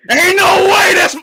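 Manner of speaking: very high, loud shriek of disbelief; disbelieving scream. Real, not acted. Streamer cannot believe it is Shanks, screaming. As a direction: scream it out in shock.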